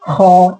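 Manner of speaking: "खो" drawn out with a throaty x fricative; thick kha. Shown as a demonstration. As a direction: say "खो" heavy and rounded, scraped in the throat. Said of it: "इसको थोड़ा सा मोटा है ना बोलना है".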